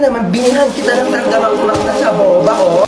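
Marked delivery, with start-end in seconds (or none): none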